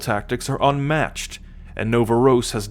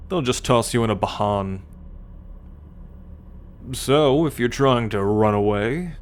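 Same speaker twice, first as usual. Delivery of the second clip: normal talking voice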